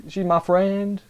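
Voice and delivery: lightly put-on girl character, pitched high; a high-pitched, half-hearted imitation of her; mock character voice, lifted well up; mimicking her voice. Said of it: "That's how she talks".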